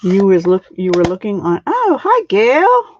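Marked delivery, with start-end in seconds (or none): 1.7-3.0 high, loud bright greeting; excited hello. Real, not acted. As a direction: brightly greet a viewer Gail. Greets live viewer brightly.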